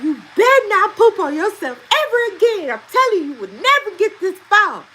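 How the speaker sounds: high, loud mock scolding; retold fussing. Mom retells threatening son with phone loss, loud high fussing. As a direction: lay down the law, re-enacting an exasperated mother. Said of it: "on the phone with my friend fussing".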